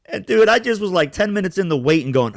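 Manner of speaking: ordinary talk, nothing marked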